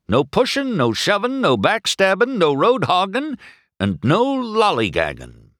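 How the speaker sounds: high, loud sheriff laying down rules; sheriff announcing. A narrator's reading. Sheriff listing race rules to the drivers, loud announcement. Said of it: "Sheriff announced".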